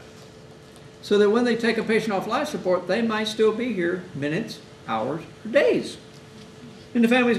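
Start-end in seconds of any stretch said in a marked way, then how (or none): none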